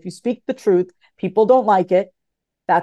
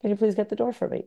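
quieter, quick polite request; softer, quicker polite ask; 7 dB quieter, faster than usual. Shown as a demonstration. Asking a man at the door for help, softly and quickly. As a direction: ask it again softly and a bit faster. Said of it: "I will say".

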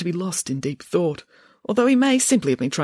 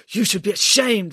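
higher, indignant director voice; scolding, put-on official's voice; denouncing; 5 semitones higher than usual. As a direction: denounce him in outrage. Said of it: "was still denouncing the minister".

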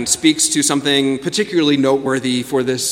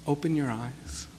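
guided meditation; 2 semitones lower, 7 dB quieter than usual. Acted. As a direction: guide them gently into stillness. Leads a guided reflection.

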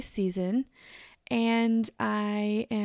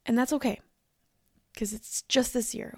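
normal talking voice

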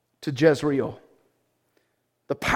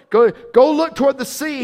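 high, loud repeated order; urgent command; 6 semitones higher, 4 dB louder than usual. Acted. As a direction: push the servant out the door. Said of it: "he told his servant".

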